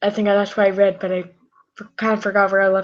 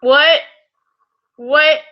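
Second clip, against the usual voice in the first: loud call; 5 semitones higher, 5 dB louder than usual. Acted. Character shouts from other room.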